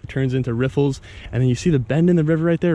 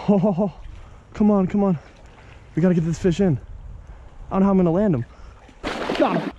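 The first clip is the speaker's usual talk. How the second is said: excited; 5 semitones higher than usual. Real, not acted. Landing the fish, straining.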